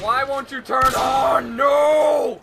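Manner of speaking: slow, loud begging shout; pleading with a machine. Real, not acted. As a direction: beg the controller to work, yelling. Shouting at dead controller.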